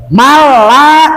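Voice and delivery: "Malah" as mal-la, held long; lengthened la. Shown as a demonstration. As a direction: double the l in "Malah" and stretch it. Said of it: "kenapa lanya dipanjangkan?".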